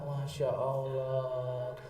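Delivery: low, soft, drawn-out sigh; mock-tearful Masya Allah after a touching line; mock moved. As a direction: breathe it out, overcome. Mock emotional reaction.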